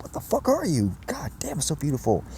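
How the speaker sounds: high-pitched incredulous wonder; retold awe. Speaker reliving the sight of the being, awed. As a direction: marvel in a high voice, easing off.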